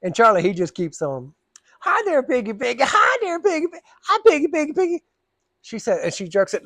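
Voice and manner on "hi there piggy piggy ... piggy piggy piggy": much higher singsong piggy calls; high baby-talk coo. Retold or imitated. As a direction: coo at the dog in a silly high voice. Charlie cooing at the dog.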